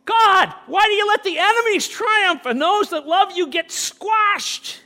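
high, loud pleading cry to God; mock complaint to God. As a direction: shout it up to heaven like you're overlooked. Acted complaint to God continues.

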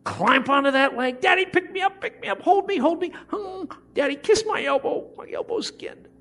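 put-on high little-kid voice, pleading to be picked up; child begging daddy. Preacher voicing his little kids begging daddy. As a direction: play a small child wanting daddy.

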